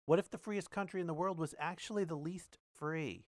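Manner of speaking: earnest read-aloud voice, each question lifted; reading an article aloud. Acted.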